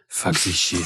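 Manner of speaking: low-voiced spider character; Gaspard. Host imagining the spider overhearing from a backpack, low character voice. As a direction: drop the pitch for a spider's grumbled aside. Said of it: "Gaspard, just going".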